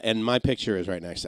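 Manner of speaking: ordinary delivery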